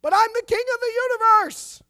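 high, booming insistent shout. A narrator's reading. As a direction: shout back at the doctor in defiance. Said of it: "he cried all the louder".

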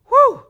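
high, loud whoop of relief; relieved whew. Acted. Forgiver shaking off bad feelings, loud acted whew of relief. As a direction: blow out a sharp, high "whew".

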